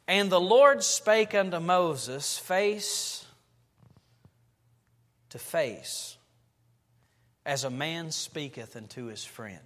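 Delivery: slow, solemn reading of the verse; scripture reading. Acted. Pastor to congregation, slow solemn scripture reading. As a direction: read the verse out slowly and gravely.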